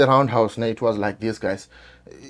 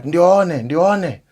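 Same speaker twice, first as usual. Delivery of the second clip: calling a name, louder and higher; retold shout; 8 semitones higher, 7 dB louder than usual. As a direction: shout the name across the yard. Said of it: "he started shouting".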